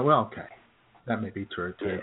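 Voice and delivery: ordinary talk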